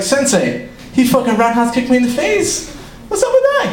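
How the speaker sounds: high, whining protest climbing at the end; mock indignant complaint. Pupil complaining to his sensei, mock indignant complaint.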